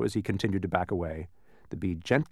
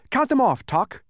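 high, loud excited call; excited boy; 6 dB louder than usual. A narrator's reading. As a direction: urge Tock on. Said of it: "cried Milo excitedly".

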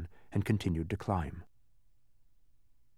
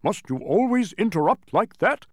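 higher, louder, irritable question; irritated watchdog character; 9 semitones higher, 6 dB louder than usual. A narrator's reading. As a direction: demand it with growing impatience. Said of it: "said Tock irritably".

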